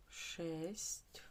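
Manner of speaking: ordinary delivery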